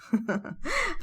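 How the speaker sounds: soft, high little laugh; quiet rising giggle; amused laugh. A narrator's reading. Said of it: "ضحكت أم سعيد وقالت".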